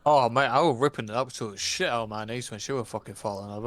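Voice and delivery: normal talking voice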